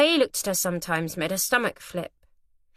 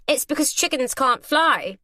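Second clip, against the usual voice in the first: eager teasing; 7 semitones higher, 4 dB louder than usual. A narrator's reading. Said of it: "Arabella shouted eagerly".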